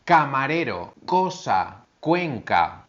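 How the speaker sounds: "Camarero", "cosa", "cuenca" with a crisp k sound; careful teacher demo of k; hard c demo. Shown as a demonstration. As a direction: model each hard c clearly. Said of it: "se pronuncia de la siguiente manera".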